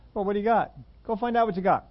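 Jesus telling disciples to go find food, brisk; Jesus prodding. Retold or imitated. Lightly voiced prodding Jesus. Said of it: "what's he say to the disciples".

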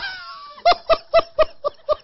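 very high, cracking laugh; shrieking laugh. Real, not acted. Laughter after the punchline.

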